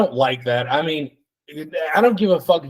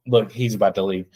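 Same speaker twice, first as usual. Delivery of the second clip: ordinary delivery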